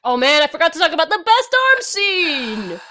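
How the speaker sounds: high and loud, suddenly remembering; excited. Real, not acted. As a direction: burst out with excitement.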